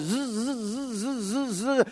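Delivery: a humming zzz, like static in the head; buzzing mind noise. Retold or imitated. Preacher mimicking a racing mind, buzzing. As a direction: buzz it like a restless mind.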